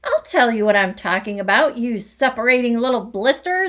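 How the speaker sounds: loud fast angry shout. Acted. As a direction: shout it in his face. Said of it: "the Trunchbull shouted".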